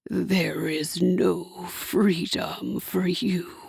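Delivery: low, very slow and cold; cold devil voice. A narrator's reading. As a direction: drop each word heavily. Hasatan's voice.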